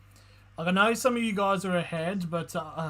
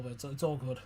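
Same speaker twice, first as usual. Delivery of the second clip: softer, sulky "all good"; passive-aggressive; 5 semitones lower, 8 dB quieter than usual. Real, not acted. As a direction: mutter it like it's clearly not fine. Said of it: "I'm sorry if I sounded bloody passive-aggressive".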